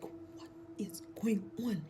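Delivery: slow, uneasy whisper; wife whispering to her husband, uneasy. Retold or imitated. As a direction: hush it out, afraid. Asking fearfully what is happening.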